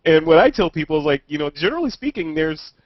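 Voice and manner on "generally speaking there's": usual speaking voice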